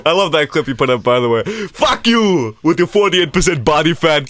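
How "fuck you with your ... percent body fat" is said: mock angry chef. Mock chef rant quoted.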